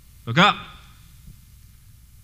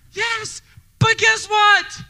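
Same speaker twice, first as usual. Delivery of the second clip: fiery preaching; 8 semitones higher than usual. Acted. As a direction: preach it out to the congregation. Preaching at full force.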